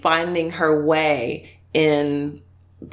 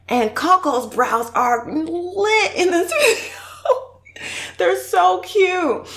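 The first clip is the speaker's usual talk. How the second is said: gushing; 13 semitones higher, much slower than usual. Real, not acted. Gushing over creator's brows.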